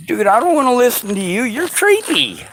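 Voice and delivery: a hint of a high, bratty brush-off; sneer, then drag out "creepy"; dismissive Russia. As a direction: Russia waving off the pleading speaker. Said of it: "And Rush is like".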